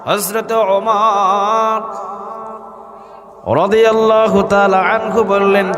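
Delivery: high, drawn-out chanting delivery; melodic preaching. A narrator's reading. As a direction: intone the holy name, stretching it long. (সুর করে টেনে টেনে ওয়াজ)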